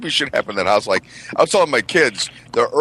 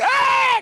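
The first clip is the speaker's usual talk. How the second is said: very high mock shriek of fright; imitated scream. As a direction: scream like a startled mom, imitated. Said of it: "And she screams".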